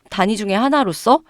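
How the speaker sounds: ordinary talk, nothing marked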